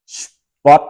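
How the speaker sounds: "Spot." với âm t cuối bật rõ; nói "Spot." bật rõ âm t cuối, giọng hơi cao; clear final t. Shown as a demonstration. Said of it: "ngậm miệng lại, xì cái". (phát âm "Spot." bật rõ t cuối)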